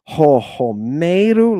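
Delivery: "Ho homeru" slowly, with "me" stressed and drawn out; slow, loud "homeru", accent on the second syllable; shifted accent. Shown as a demonstration. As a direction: lean hard and loud on the middle of "homeru". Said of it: "Look where the accent has changed".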